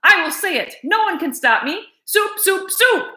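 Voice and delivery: high, rising defiant cry; defiant outburst. Acted. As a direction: say it no matter what. Cook defiant, rising to crying.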